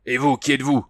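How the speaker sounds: bristling sergeant demanding a name; harsh. A narrator's reading. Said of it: "demanda-t-il durement". (dur et sec, plus fort)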